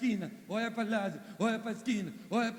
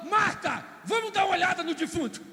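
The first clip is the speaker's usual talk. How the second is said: much higher and louder, a big announcing voice; booming call; 8 semitones higher, 5 dB louder than usual. Acted. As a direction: summon Marta like a herald. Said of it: "Jesus falou". (Jesus chamando Marta em voz alta e aguda)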